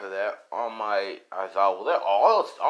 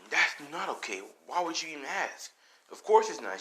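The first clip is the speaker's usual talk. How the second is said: higher-pitched indignant protest; protesting captive; 7 semitones higher than usual. Acted. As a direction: object in a raised pitch. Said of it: "And he's like".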